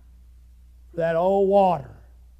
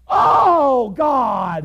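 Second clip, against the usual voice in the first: high sudden cry to God; anguished cry; 8 semitones higher, 8 dB louder than usual. Acted. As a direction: wail it from the flames. Rich man crying out.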